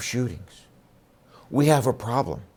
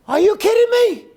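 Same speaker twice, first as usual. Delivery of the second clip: much higher, louder cry of disbelief; incredulous outburst; 6 dB louder than usual. Real, not acted. Speaker to the crowd about injection sites, loud incredulous yelp. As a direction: yelp it as if the idea is absurd. Mocking drug-site chatter, disbelief.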